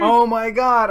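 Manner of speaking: loud, high shocked cry; horrified gasp of a line. Retold or imitated. Son seeing the photo, shocked loud cry. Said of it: "And I go".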